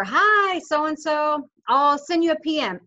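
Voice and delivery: high bubbly hello; excited greeting. Shown as a demonstration. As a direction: sound thrilled to hear from them. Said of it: "exclamation mark, smiley face".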